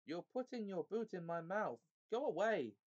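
high, indignant protest building to a loud outburst; Trumpkin bellowing. A narrator's reading. Dwarf with a boot in his mouth, bellowing. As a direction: complain crossly, then burst out on the last words. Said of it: "bellowed Trumpkin".

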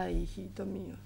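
put-on worn-out, hushed confession to a child; exhausted. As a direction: let the voice fade into a weary whisper. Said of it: "estoy tan cansada".

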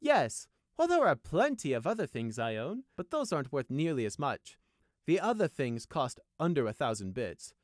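high, airy noble brushing it off; airily dismissive. A narrator's reading. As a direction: wave the lesser things away lightly. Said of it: "answered airily".

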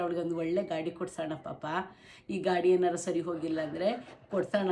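low, soft voicing of a gentle father; lightly voiced caring dad; quoting the father. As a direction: quietly voice the father's loving offer. Mother quotes father's kind words.

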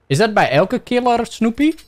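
ordinary delivery

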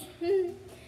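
high, mischievous giggle; short high-pitched titter of triumph; gleeful cackle. A narrator's reading. Peeves laughing at tricked Filch, high cackle.

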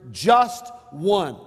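"just one" said high and loud, "just" punched; stress on just one. Shown as a demonstration. Pastor pointing the congregation to the words. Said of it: "notice the words here".

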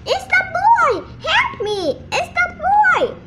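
high, loud cry of alarm; urgent high-pitched yell; crying for help. A narrator's reading. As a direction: call out high as if in danger. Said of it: "she heard someone cry for help".

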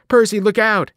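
loud, high shout of alarm; cried warning. A narrator's reading. Annabeth warning Percy of the attacking Fury, loud high cry. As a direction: scream a warning across the bus. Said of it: "Annabeth cried".

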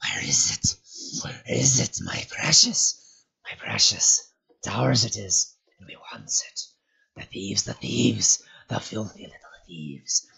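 hissed whispers, slow and wheedling, then spitting; sharp hissing Gollum. A narrator's reading. Gollum hissing to himself about the thieves. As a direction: hiss out the search for the lost treasure. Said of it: "the hisses became sharper and clearer".

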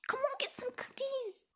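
urgent whisper. Acted. Sister urging her sister to grab cookies, whispered. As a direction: hurry her along in a whisper. Sneaking snacks.

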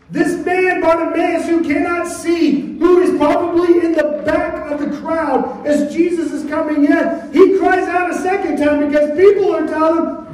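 high-pitched, driving sermon delivery; impassioned preaching. Acted. Preacher retelling the blind man's second cry, impassioned. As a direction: hold the pitch up and press on.

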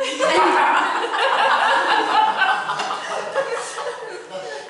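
high rising helpless laugh; long laugh. Real, not acted. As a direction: break up laughing. Laughing at own story.

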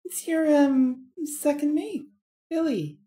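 high, strange reptile voice; sly sticky chameleon; lizard voice. Acted. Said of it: "with the strange lizard voice".

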